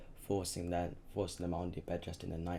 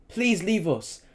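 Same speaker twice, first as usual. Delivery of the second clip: high, loud terrified plea; mother screaming at the soldiers; screaming with fear; 11 semitones higher, 13 dB louder, slower than usual. A narrator's reading. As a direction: beg for your family's life. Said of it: "screamed with fear".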